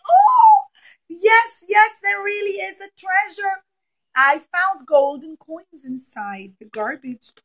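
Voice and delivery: high, loud shout of discovery; overjoyed treasure-hunter yelling out; excited shout. Acted. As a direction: yell it like you just struck gold. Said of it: "someone would scream and say".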